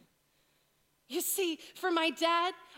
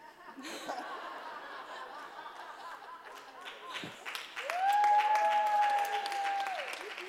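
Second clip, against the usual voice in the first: high, long burst of laughter; loud laughter; 11 semitones higher than usual. Real, not acted. Preacher laughs at his own slip about buts. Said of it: "It just went down the wrong road".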